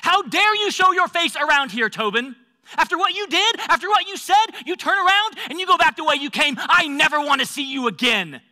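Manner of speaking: loud, high, furious tirade; verbal attack. Acted. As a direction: lash out loudly, driving them away. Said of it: "verbally attack me".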